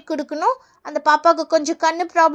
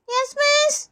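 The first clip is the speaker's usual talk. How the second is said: high schoolkid roll-call answer; reply in a little kid's voice; child pupil; 6 semitones higher, much slower than usual. Acted. Pupil answering the teacher's call, high child voice.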